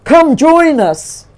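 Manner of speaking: a bear shouting to a stranger to come over; hollering. Acted. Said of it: "he hollered".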